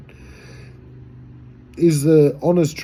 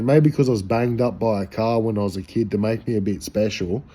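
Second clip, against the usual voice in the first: normal talking voice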